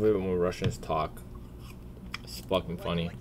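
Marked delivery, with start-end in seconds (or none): none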